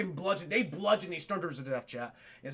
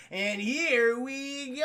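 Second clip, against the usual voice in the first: mock pilot cue; 4 semitones higher, much slower than usual. Retold pilot's confident cue.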